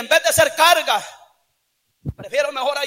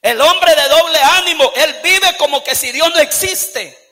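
loud, impassioned sermon delivery; fiery preaching; 4 dB louder than usual. Acted. Pastor preaching hard to the church, impassioned shout. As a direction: preach it with fire to the congregation.

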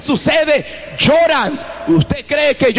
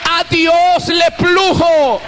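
raised, fervent preaching voice; 7 semitones higher than usual. Acted. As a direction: preach it to the whole congregation. Preaching again to congregation.

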